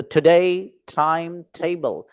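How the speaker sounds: Time table said slowly, stress on time; slow and even, lean on time; slow model reading. Shown as a demonstration. Teacher drills class in slow clear reading. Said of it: "तीन बार पढ़ना है आपको इस तरीके से".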